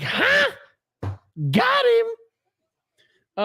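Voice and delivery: high mock shout; mocking Gavin. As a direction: ham up a gotcha yell. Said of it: "Gavin wants to be like".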